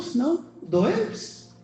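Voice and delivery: ordinary talk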